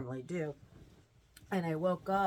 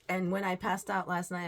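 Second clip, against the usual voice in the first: ordinary talk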